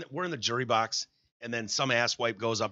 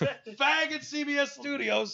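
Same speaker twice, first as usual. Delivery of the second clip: much higher, slow drawn-out put-on voice; slower mock high voice; 13 semitones higher, slower than usual. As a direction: stretch it out in a silly high voice.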